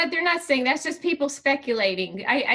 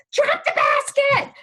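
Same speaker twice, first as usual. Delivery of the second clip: much higher, slow alarmed command; panicked yell; 11 semitones higher, 4 dB louder, slower than usual. Retold or imitated. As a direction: shout it out in alarm. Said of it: "And I'm like".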